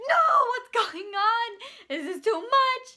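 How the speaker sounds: high, loud reenacted distress; overwhelmed yelling. Worker watches Nancy being forced onto gurney, overwhelmed shout. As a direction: cry out as if it's all too much.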